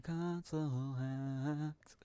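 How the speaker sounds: stretched-out, slow mock call; playful drawl. Streamer hunting for console hacks, drawn out playfully. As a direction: drag each word out playfully.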